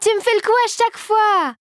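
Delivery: high, mock-indignant complaint; playful protest. A narrator's reading. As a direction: tease back, voice up high. Marie protests while laughing.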